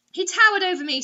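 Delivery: normal talking voice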